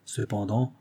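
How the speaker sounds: ordinary delivery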